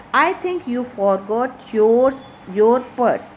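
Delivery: "your" drawn out and loud; stress on your. Shown as a demonstration. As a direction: slowly, punch "yours, your" as the key words. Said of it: "importance is given to your word".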